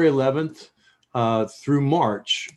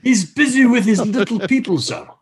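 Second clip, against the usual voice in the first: mock Scottish servant; 10 semitones higher, 5 dB louder than usual. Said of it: "Scottish servant bit".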